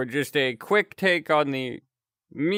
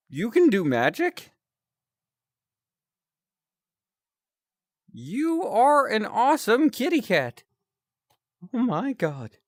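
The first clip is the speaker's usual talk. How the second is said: louder, higher, amazed; excited high voice; 9 semitones higher, 3 dB louder than usual. Acted. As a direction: gush with amazement.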